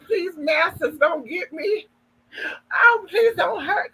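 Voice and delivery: high-pitched, hammed-up pleading; mock begging. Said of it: "She begged them".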